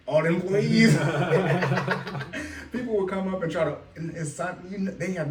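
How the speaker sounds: laughing loudly through the punchline; words bubbling over with a laugh; loud laughing storytelling. Real, not acted. Laughing while telling the story.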